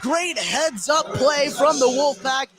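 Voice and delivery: normal talking voice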